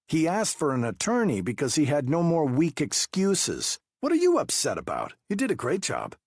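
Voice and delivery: high, pressing investigator voice; interrogating detective. Acted. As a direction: push him with pointed questions. Wu dismissive to narrator.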